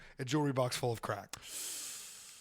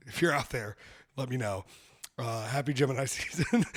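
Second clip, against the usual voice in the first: usual speaking voice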